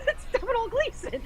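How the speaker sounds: very high, laughing squeal; giddy recognition. Retold or imitated. Viewer spotting a favorite actor mid-movie, giddy squeal. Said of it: "And I'd be like".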